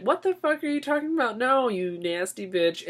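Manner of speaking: high, sharp mock street fighter. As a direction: snap it at her, high and scrappy. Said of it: "And [name] would be like".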